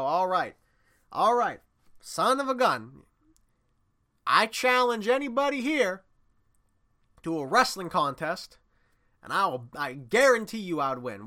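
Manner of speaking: loud, high, showman's dare to the whole town; booming challenge. Retold or imitated. Wrestler challenging the whole town, loud booming dare. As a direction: call out the crowd like a carnival barker. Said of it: "And the wrestler would go".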